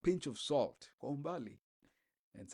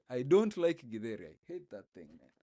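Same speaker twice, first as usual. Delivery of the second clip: strong Kenyan accent, louder; 7 dB louder than usual. Shown as a demonstration. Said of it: "Let me even bring my Kenyan accent".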